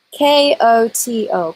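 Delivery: slow, letter by letter; spelling out. Real, not acted. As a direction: spell it out clearly for the listeners. Spelling the word for viewers.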